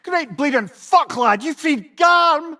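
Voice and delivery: appalled, slowed shouted disbelief; shocked outburst. Acted. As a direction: blurt it out in disbelief. Shocked at Garm's release.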